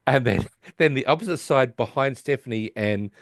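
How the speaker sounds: ordinary talk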